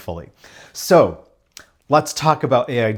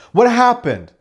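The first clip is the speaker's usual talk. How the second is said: sharp, loud "What happened?" from an Italian mom; high, loud mock-mother blurt; 9 semitones higher, 5 dB louder than usual. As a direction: demand it like a worried mother. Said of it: "every Italian person says".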